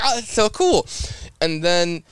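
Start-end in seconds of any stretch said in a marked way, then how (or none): 0.0-0.8 high-pitched, awed reaction; thrilled. Retold or imitated. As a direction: marvel at it, pitched well up. Retells own thrilled reaction.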